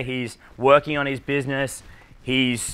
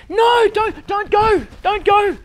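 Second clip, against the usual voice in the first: high falsetto cry of don't go; mock a girl begging him to stay, squealing high; hammed-up high girly plea; pleading girl; 4 dB louder, slower than usual. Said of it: "Sometimes the girls will be like".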